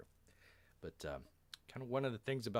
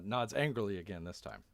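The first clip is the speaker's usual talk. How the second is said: usual speaking voice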